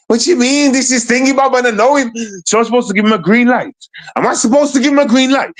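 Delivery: can't believe what he's hearing; scoffing outrage, voice shooting up; incredulous disbelief. Real, not acted. Incredulous at callers' logic.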